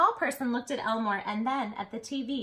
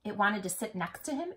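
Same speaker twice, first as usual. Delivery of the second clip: ordinary delivery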